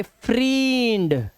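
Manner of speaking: फ्रींड with a long, drawn-out "ee". Shown as a demonstration. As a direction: stretch the vowel of फ्रींड to "freend". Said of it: "तो ये फ्रींड होना चाहिए". (फ्रींड में लंबी ई खींचकर)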